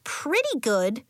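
put-on a lighter young girl voice; Nori's voice. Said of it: "Nori said".

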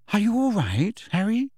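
put-on high, rising girl's voice; Hermione's concerned question. A narrator's reading. Hermione peering at Harry over her quill, concerned. Hermione asks after shaken friend.

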